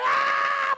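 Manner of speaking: high-pitched mock shriek; sudden full scream; loud scream. Woman interrupting the preacher, loud mock scream.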